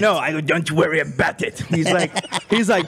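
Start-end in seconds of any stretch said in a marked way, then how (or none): 0.4-1.6 croaking sinister villain impression; the Emperor. Drunk prankster to angry man on phone, villain impression. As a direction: brush them off like an evil overlord. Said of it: "I start imitating the Emperor from Star Wars".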